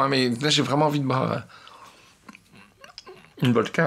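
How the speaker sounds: chewy food muffling words; talking while chewing. Real, not acted. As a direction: mouth full of tough jerky. Seen 0: Eats orange strips at +10.3, then chews visibly; package suggests cured meat.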